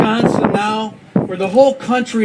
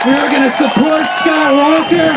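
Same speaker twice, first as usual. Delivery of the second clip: rally speech; 4 semitones higher than usual. Real, not acted. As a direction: build to a roaring campaign finish. Rally climax building to shout.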